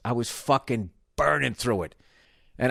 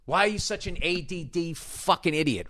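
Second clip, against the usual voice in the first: higher, exasperated inner critic berating himself; self-scolding rant; 7 semitones higher than usual. Retold or imitated. Berating himself over the forgotten label, higher and exasperated. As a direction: scold yourself like a fed-up parent. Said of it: "It's like".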